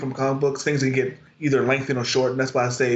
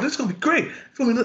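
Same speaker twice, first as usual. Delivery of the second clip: excited; 10 semitones higher, faster than usual. Retold or imitated. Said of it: "we were very excited".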